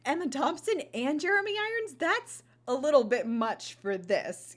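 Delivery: put-on incredulity, rising high then easing; incredulous reaction. Viewer reacting to yet another star turning up in the film, incredulous.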